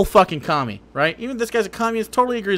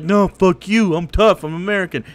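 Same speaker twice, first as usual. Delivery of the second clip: mock tough guy; 5 dB louder than usual. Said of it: "And he's like".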